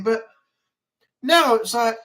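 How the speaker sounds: ordinary talk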